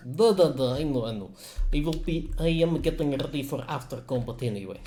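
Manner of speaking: dismissive Russian doctor; Russian accent. Acted. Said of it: "I like my Russian accent".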